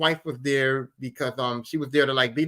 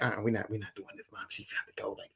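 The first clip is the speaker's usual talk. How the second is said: imitating a low, hushed objection; retold muttered complaint; 5 semitones lower, 12 dB quieter than usual. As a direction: grumble it under your breath. Older sister's muttered complaint.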